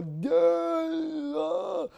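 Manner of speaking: high whiny grunt; mock baby grunt; imitated toddler grunt. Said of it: "grunts at me".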